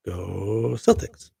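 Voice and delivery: slow, stretched Go Celtics; drawn-out cheer. Real, not acted. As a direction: draw out every syllable, rising at the end. Cheering on the Boston team.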